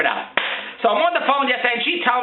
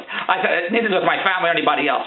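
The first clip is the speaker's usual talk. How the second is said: ordinary talk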